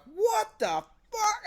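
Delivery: a stretched-out, high shocked yell; outraged disbelief. Retold or imitated. Moses sees the golden bull.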